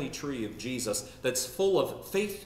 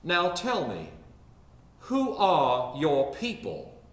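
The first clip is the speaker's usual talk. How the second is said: put-on elderly Richmond lady; 3 semitones higher, 3 dB louder, slower than usual. Elderly lady quizzes younger woman at wedding, slow Richmond drawl. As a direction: imitate a Richmond matron, drawing out each word in a Southern accent. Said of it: "elderly lady asked the younger one".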